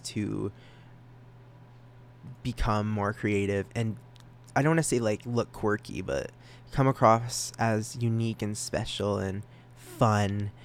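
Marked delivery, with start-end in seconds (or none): none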